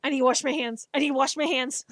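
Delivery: loud, urgent mock panic; mock freak-out. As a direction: repeat it loudly as if freaking out. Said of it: "I will freak out".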